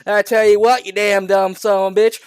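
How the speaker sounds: loud, high hillbilly mayor; hammed-up hillbilly voice; hillbilly accent. A narrator's reading. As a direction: rant like an irate hillbilly. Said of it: "with his hillbilly accent".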